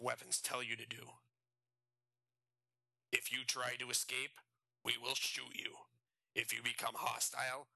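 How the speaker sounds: lightly raspy prison guard; a hint of a rough guard. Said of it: "a guard with a raspy voice said".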